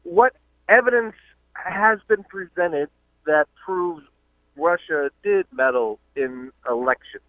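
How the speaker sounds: ordinary talk, nothing marked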